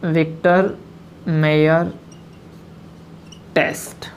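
word by word with long pauses; teacher dictating one word at a time; spelled out slowly. Shown as a demonstration. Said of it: "ये देखो, Victor".

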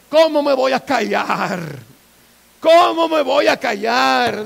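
loud, drawn-out protest; defiant crying out. Acted. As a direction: refuse to be hushed, calling out. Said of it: "clamó".

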